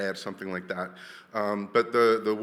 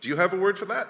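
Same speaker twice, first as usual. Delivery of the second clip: normal talking voice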